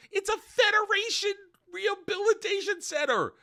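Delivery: high, loud, shrieking mock meltdown; hysterical mock freakout. Sentenced prisoner mocking her rehab sentence, hysterical high shriek. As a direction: squeal it like a panicked prisoner being sentenced. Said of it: "which she goes".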